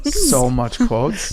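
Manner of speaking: slow and drawn out, amused; slow, drawn-out savouring. Retold or imitated. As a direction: stretch each word, savouring it. Host savours the slip, slowly.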